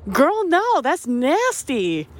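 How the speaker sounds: high, disbelieving recoil; rising into a grossed-out 'girl, no'; incredulous disgust. Retold or imitated. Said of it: "and I'm like".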